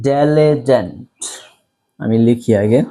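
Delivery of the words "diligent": diligent stretched out very slowly, syllable by syllable; slow model pronunciation. Shown as a demonstration. Teacher modelling a word for students, very slow. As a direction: spell out diligent slowly for the class. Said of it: "প্রথম শব্দটা হচ্ছে".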